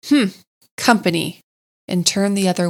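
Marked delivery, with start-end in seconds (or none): 0.1-1.4 grunted disdain. A narrator's reading. Badger on seeing the picnickers, grunted disdain. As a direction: grunt it dismissively. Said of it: "then grunted".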